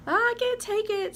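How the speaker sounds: high, loud overwhelmed cry; hammed-up tearful outburst; mock sobbing wail. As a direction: wail it, retelling your own sobbing. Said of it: "So I'm, like, sobbing".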